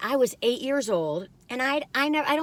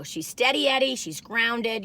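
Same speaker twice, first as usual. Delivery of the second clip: ordinary talk, nothing marked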